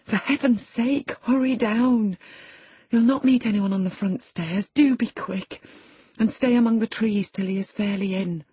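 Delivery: high, pressing plea to hurry; urgent warning. Acted. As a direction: urge them out before they're caught. Servant urges lover to flee.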